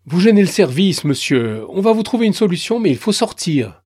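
plus haut et fort, pressé; vigile poli mais ferme. Acted. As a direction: ordonner de sortir, poliment mais sans appel. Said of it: "Le ton est poli, mais ferme". (vigile corpulent, ton poli mais ferme)

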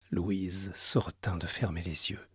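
low, hushed dark storytelling; story narration. A narrator's reading. Narration resumes.

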